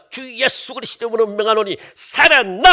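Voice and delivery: loud, high commanding prayer; mock healing command. Preacher mimicking someone praying over the dead, mock healing command. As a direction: order it out forcefully, punching the last word. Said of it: "막 안수할 거야".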